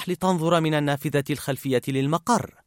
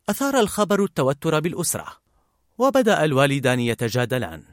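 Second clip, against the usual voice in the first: ordinary delivery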